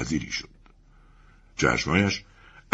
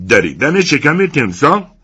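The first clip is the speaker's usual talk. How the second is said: higher, loud outraged owner; shouting; 10 semitones higher, 6 dB louder than usual. Acted. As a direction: bellow in protest. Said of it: "فریاد زد".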